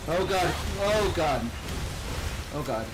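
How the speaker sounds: loud panicked gasps of oh god; panicking under pressure. Real, not acted. Player panics in boss fight.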